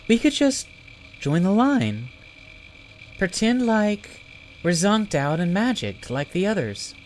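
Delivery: put-on high, eager girl voice; lightly voiced hopeful girl; young girl voice. A narrator's reading. Zelly's girlish voice.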